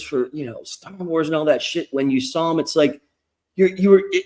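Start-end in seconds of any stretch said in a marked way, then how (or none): none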